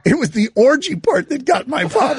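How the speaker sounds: high-pitched, talking through real laughter; laughing. Real, not acted. Host telling how the orgy part got his father, laughing. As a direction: barely get it out for laughing.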